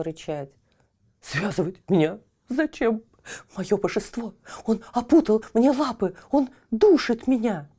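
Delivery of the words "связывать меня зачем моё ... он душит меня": high, rough, panicky dog voice; choked hound appealing to its master; distressed dog. A narrator's reading. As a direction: protest in alarm, growing more frantic. Dog protests being tied up.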